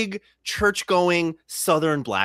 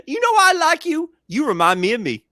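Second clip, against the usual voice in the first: put-on old lady quote; 12 semitones higher, 7 dB louder than usual.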